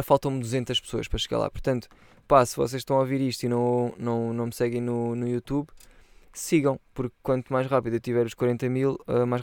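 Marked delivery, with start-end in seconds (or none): none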